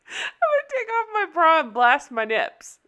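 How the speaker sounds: high-pitched imitated yell, fighting laughter; mock yell. Retelling friend's outburst at the club, mock yell. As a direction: push it up high like a half-laughed holler. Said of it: "Artemis yells out".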